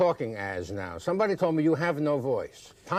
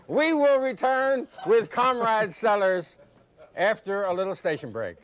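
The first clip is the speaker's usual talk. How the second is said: slow, high, drawn-out announcer call; host's break announcement; 7 semitones higher, 3 dB louder, slower than usual. Real, not acted. Host announces the station break.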